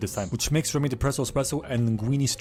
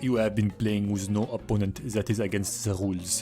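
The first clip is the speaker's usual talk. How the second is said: slower, hammed-up French accent for the critic; mock French accent; 3 semitones lower than usual. Recapper voices the critic challenging the chef, mock French accent. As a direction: pronounce each word like a haughty French gourmet. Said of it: "I'm doing a French one".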